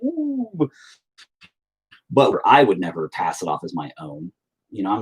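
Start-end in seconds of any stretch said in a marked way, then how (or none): 0.0-0.7 high drawn-out ooh; a let-down high ooh; mock disappointed ooh. Kids looking at their failed redraw, a high ooh. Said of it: "We'd be like".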